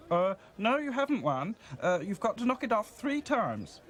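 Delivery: high, hasty shady showman; Toby Martin stalling the angry man, flustered; shifty stallholder. A narrator's reading. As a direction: bluff his way out. Toby Martin thinks quickly.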